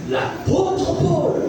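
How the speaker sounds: normal talking voice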